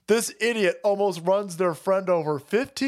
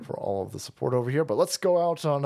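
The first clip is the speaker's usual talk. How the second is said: ordinary delivery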